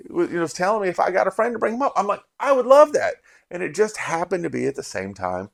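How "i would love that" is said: mock excited, louder and higher; eager reply. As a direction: jump at the offer with delight. Narrator's own eager reply retold.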